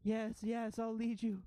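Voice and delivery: high, loud creature voice; eager frightened ratling. Acted. Frightened ratling agrees to lead the ghosts, high eager voice. Game master plays the ratling.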